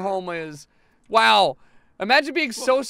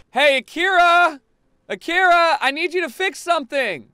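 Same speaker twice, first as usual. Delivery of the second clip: raised, high call for help; calling out urgently; 9 semitones higher, 7 dB louder than usual. Real, not acted. Player summoning a game character, calling out. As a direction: holler for someone to come fix it.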